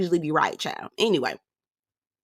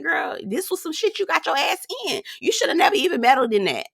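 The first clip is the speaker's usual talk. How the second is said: lecturing Diamond; 10 semitones higher than usual. Pretend. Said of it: "I said".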